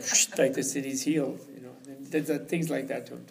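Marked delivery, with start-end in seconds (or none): 0.0-0.3 short hushing whoosh noise; imitated whoosh. Teacher heals the broken disciple, imitated shh noise. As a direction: make a quick sibilant healing sound. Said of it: "Tilopa goes like".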